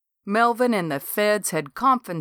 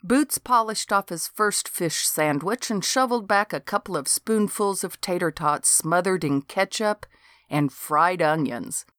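plain reading voice

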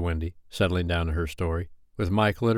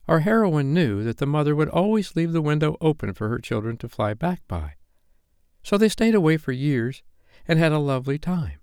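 higher storyteller voice of a complacent Wendy; Wendy telling a story; 7 semitones higher than usual. Acted. Wendy telling the boys of the heroine, storytelling. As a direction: narrate to children in a raised pitch.

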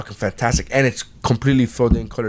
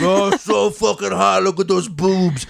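leering friend voice; 6 semitones higher, slower than usual. Retold or imitated.

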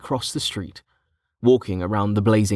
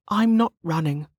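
conflicted denial; 8 semitones higher than usual. A narrator's reading. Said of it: "though she felt conflicted as she spoke".

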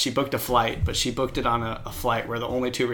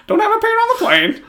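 much higher and louder parrot copy; parrot echo; 10 dB louder than usual. Retold or imitated. Parrot echoing its owner's denial, high loud parrot voice. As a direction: blurt it back like a mimicking parrot.

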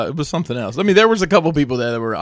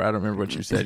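ordinary talk, nothing marked

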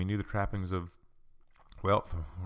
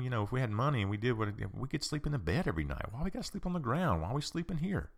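higher-pitched whining of a reluctant follower; mock complaining; 4 semitones higher than usual. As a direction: act out a griping travel companion, pitch swooping up. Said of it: "walking around with him complaining".

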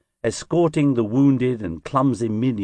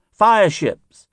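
higher, louder cry of warning; alarmed cry; 9 semitones higher, 5 dB louder than usual. A narrator's reading. A sailor warns the crew of fireships, alarmed cry. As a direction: call out the danger to all aboard. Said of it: "a cry came up".

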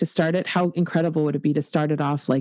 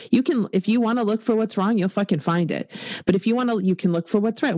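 ordinary talk

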